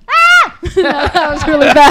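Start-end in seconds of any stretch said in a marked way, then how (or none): 0.1-0.6 loud high goat scream. Shown as a demonstration. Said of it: "I'm really good at a goat impression ... screaming ones".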